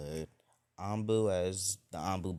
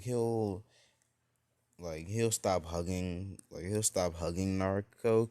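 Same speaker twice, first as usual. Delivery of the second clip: ordinary delivery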